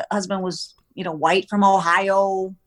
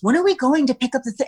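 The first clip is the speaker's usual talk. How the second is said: quick, higher-pitched clueless question; imitating clueless husband; 5 semitones higher, 4 dB louder, faster than usual. Wife mimics husband who didn't listen, clueless. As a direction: imitate him asking as if never told. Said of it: "he goes".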